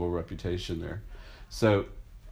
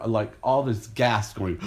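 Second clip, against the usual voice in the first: ordinary delivery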